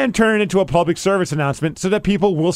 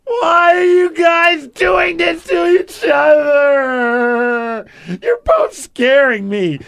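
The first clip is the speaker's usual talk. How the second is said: much higher, slow, mock sobbing shouts; hammed-up weepy wail; 6 semitones higher, much slower than usual. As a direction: overdo the tearful pleading, shouting through fake tears. Michelle overwrought weepy post.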